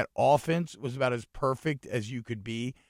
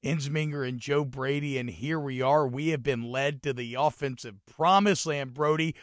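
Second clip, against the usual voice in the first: ordinary delivery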